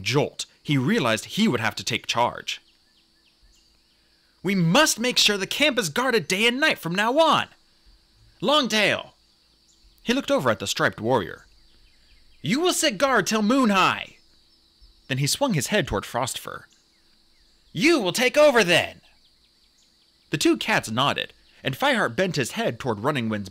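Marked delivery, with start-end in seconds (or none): 4.4-9.1 high, raised commanding voice; firm, urgent command; taking charge. A narrator's reading. Deputy takes charge, gives orders. 12.4-14.2 high, raised commanding voice; firm, urgent command; taking charge. A narrator's reading. Deputy orders a warrior. 17.7-19.0 high, raised commanding voice; firm, urgent command; taking charge. A narrator's reading. Deputy orders a warrior.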